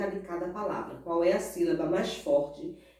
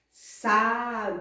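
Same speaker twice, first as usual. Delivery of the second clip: "Sabiá" drawn out as sa-bi-á; syllable by syllable. Shown as a demonstration. Teacher reads the word to class, syllable by syllable. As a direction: say "Sabiá" in three separate, stretched syllables. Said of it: "pronunciando cada uma das suas sílabas".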